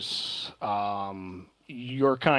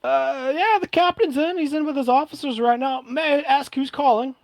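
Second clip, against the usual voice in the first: much higher, louder call from the deck; masked sailor hailing; 12 semitones higher, 4 dB louder than usual. Acted. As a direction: call out to a stranger at the gangplank, high and bright.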